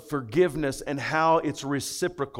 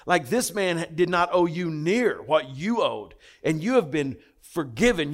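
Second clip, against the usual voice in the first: ordinary talk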